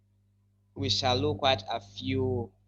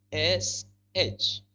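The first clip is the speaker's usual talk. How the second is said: ordinary talk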